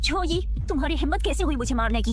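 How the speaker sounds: contained outrage. Acted. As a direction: challenge the attacker, anger held in. First outraged reaction to being hit. (दबे गुस्से में सवाल)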